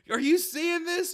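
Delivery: put-on very high, loud, drawn-out drunken panic; freaking out. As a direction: wail it like a drunk friend seeing disaster. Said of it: "He's like".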